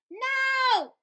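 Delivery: high, loud group yell. A narrator's reading. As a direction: yell back in unison. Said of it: "the crowd yelled".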